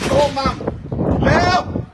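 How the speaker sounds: half-joking high call for Mum as the wind hits; mock cry for help. Seller in the wind jokingly calls Mum for help, mock panic.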